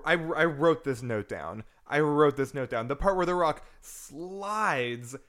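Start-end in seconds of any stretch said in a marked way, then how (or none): 2.9-5.2 loud, high, punchy fan gushing; real excitement. Real, not acted. Fan recounting The Rock hitting the wall, excited. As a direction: relive the stunt, drawing out the key verb.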